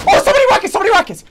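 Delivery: high alarmed yell; alarmed shout. Real, not acted. Alarm at incoming rockets.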